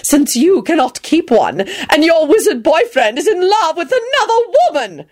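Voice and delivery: high-pitched spiteful taunt; haughty, cutting jibe; vicious. A narrator's reading. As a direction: rub it in cruelly. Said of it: "she added viciously".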